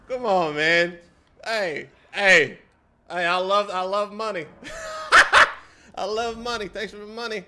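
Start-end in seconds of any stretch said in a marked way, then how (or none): 4.5-5.6 very high loud squeal of laughter. Real, not acted. Streamer thanking for money, high squealing laugh. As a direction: shriek with glee.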